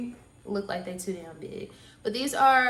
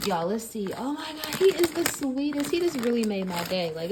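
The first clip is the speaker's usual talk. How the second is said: normal talking voice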